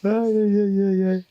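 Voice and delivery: comic aïe aïe after a flub; mock dismay cry. Real, not acted. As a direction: cry out in playful frustration.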